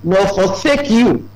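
high, slow, weighty quoting of God's promise; slow lifted proclamation; solemn scripture quote. Retold or imitated. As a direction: raise the voice and stretch it out like a proclamation. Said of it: "He said".

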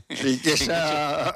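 drawn out through a laugh. Real, not acted. Joking back to a fellow panellist about riches, laughing. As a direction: let the word ride slowly on laughter.

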